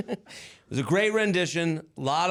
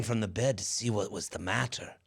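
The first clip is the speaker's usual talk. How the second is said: hushed whisper; 8 semitones lower, 5 dB quieter than usual. Acted. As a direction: whisper the line under your breath. Whispered line in recited poem.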